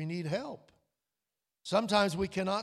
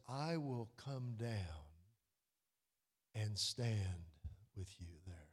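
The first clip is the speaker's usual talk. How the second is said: soft, slow and dropping away; a reverent near-hush; hushed scripture quote; 8 semitones lower, 9 dB quieter than usual. Shown as a demonstration. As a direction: let the words fade low in awe. Quoting God softly.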